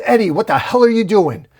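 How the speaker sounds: high, loud shocked yell; alarmed yell. A narrator's reading. Venom yelling at Eddie as Carnage grabs her, high and loud. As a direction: shout at him in disbelief. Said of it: "as Venom yells".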